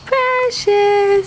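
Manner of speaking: high, sing-song call to the cat; calling a pet. Real, not acted.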